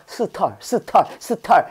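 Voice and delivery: "스털" broken into 스 and a stressed 털; split-syllable demo. Shown as a demonstration. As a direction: say "스털" in two separate beats, like the word 털. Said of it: "여기 털이 있다고 생각해". ("스털"을 스, 털로 끊어서 또박또박)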